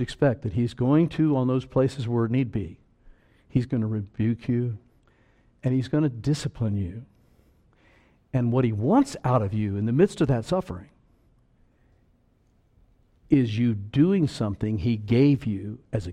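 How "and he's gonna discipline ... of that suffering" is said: usual speaking voice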